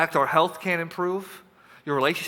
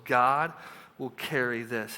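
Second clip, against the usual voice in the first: usual speaking voice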